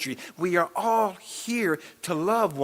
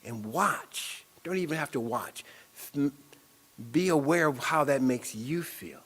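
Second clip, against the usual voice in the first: ordinary talk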